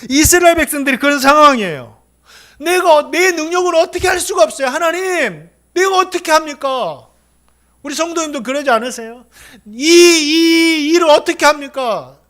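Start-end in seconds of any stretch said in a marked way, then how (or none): 2.6-7.1 higher, louder anguished plea to God; desperate lament. Acted. Preacher voicing a helpless believer, pleading in anguish. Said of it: "주여 하고 탄식이 나오는 거죠". 9.7-12.2 slow, higher and louder groaning plea; drawn-out lament. Acted. Preacher acting a believer groaning before God, slow and anguished. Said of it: "주여 하고 탄식이 나오는 거죠".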